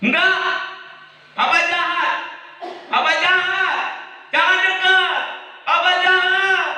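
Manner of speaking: put-on child rejecting his father. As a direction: shout at Papa to keep away. Child pushes father away.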